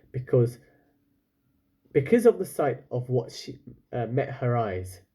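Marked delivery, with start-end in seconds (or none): none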